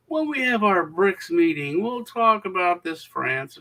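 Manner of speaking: cocky, unhurried mock statesman; swagger. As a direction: lay it on like a leader who knows he has leverage. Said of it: "talking with a swagger".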